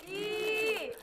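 loud, high, drawn-out countdown; excited countdown. Real, not acted. Loud countdown to open gift.

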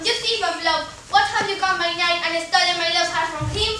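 normal talking voice

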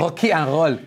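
put-on joking Brazilian accent on the English phrase. Presenter capping the joke, Brazilian accent. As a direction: turn the Rs into Brazilian h sounds.

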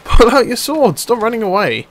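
loud, high exasperated shout at the screen; frustrated yelling. Real, not acted. Gamer yelling at his hapless teammate to fight, loud and high. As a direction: yell at a useless teammate.

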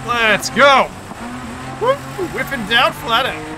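high, loud racing whoop; pumped at full speed; yelling flat out; hyped yell. Real, not acted.

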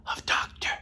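hushed, awed whisper; reverent whisper. Acted. Comic host whispering the title to teachers. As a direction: breathe the word doctor.

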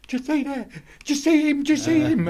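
high, loud, frantic imitation of a man seeing a devil; panicky tripping clubber. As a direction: mimic him begging you to see it too. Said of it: "and he's going".

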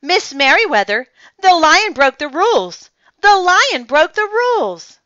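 loud, high tattling call; excited calling. Acted. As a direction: shout the news as you hurry. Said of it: "he called as he walked".

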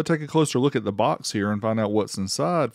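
ordinary talk

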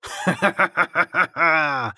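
loud, high mocking cackle; staccato prankster's laugh; laughter. A narrator's reading. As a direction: burst out in a long, loud laugh. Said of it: "ozval se smích".

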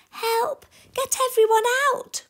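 high, loud cry for help; urgent call. Acted. Sam calls out as the fire spreads, urgent shouting. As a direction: shout the alarm high and fast. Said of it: "called Sam".

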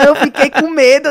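loud, high-pitched comic protest; protesto exaltado. Real, not acted. Loudly denies crying, amid laughter. (protesto bem-humorado, alto e agudo)

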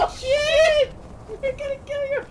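high loud alarmed cry; alarmed shout. Real, not acted. Friend alarmed at the fall.

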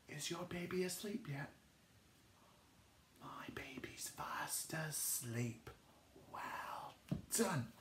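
soft hushed whisper. Shown as a demonstration. Teacher whispering so the baby stays asleep. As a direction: keep whispering, baby's asleep.

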